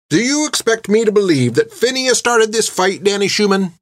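high, disbelieving scolding question; incredulous stern nun. A narrator's reading. Nun rounding on the bully, incredulous scolding. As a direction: scorn his lie. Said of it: "She rounded back on Danny".

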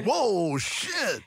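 high-pitched mock astonishment; retold surprise. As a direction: act out being taken aback. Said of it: "It took me by surprise".

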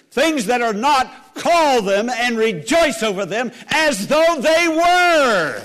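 loud, high, drawn-out preaching; sermon climax cried out to the congregation; climactic exhortation. Real, not acted. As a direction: proclaim it at full force, stretching the last words. Verse repeated as climax of exhortation.

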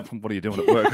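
laughing at own slip. Retold or imitated. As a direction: chuckle at your own mistake as you repeat it. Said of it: "I said, what are you doing at school".

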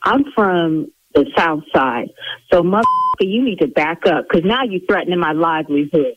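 low, deliberate threat; menacing warning. Real, not acted. Salon owner warns caller threatening her livelihood, low and menacing.

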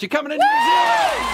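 excited shout. Real, not acted. Announcing the prize win.

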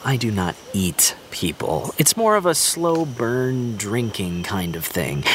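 ordinary talk, nothing marked

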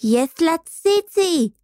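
slow, high-pitched squeal; נוצי screeching. A narrator's reading. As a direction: screech it high, drawing out the end. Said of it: "צרחה נוצי". (צווחה גבוהה ומתמשכת)

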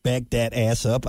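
slow "Back dat ass up" with dat as dat; quoting the song. Retold or imitated. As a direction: drawl "dat" like the rap hook. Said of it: "you can't say back that ass up".

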